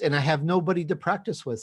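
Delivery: ordinary delivery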